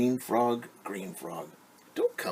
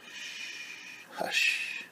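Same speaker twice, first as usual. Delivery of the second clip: whispered hush. Acted. Mother hushing animals near sleeping baby, whispered.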